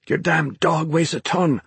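high, straining complaint; strained grunting. A narrator's reading. Ben to Naomi while dragging her dog, strained grunt. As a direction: complain through heavy effort. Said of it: "he grunted".

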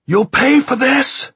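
high, loud, drawn-out howl; howling threat. A narrator's reading. Locked-in man threatening his captors, howling. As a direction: howl it at the top of your voice. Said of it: "he howled".

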